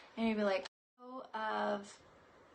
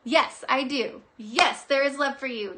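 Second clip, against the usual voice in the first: ordinary talk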